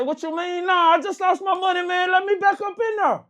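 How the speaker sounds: high, pushy, rising frustration; heated demand. Retold or imitated. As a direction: press him hard to get back in. Demanding to be let back in.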